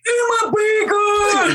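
high, drawn-out mimicked kid cry; little boy's voice. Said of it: "And he just goes".

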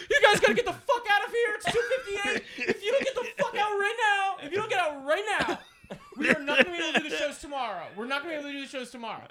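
high, fast, frantic announcer laughing through it; announcer over the mic. Retold or imitated. Announcer over the mic ordering fans to leave, high and frantic. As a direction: rush everyone out like a panicked ring announcer. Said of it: "some guy over the God mic going".

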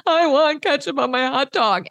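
mock a five-year-old begging for ketchup; little sister crying for ketchup, lightly mimicked. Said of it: "cry and say".

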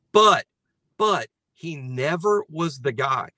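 normal talking voice

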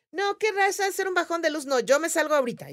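high-pitched retold protest; alarmed refusal. Group leader retelling her refusal to stay, urgent high protest. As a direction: act out a worried, urgent refusal.

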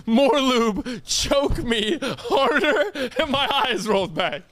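high, drawn-out mock pleading; hammed-up and high, breaking into laughter; mocking echo. Friend parodying what he supposedly said, mocking. Mockingly acts out alleged requests.

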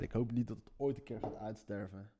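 ordinary talk

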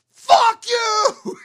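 high, full-throated parting scream; furious shout. Retold or imitated. Angry shopper at the open door cursing them, screaming. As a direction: yell it loud back through the door. Re-enacting storming out of store.

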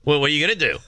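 mock challenge, lifted pitch and level; retold defiance. Son daring his mother, defiant imitation. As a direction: throw it back like a cheeky kid. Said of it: "he gets, like, defiant with her".

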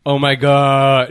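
slow, mock-orgasmic swoon; imitating someone overcome with pleasure; Elliot. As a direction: drag it out in exaggerated bliss. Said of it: "orgasmic about shit".

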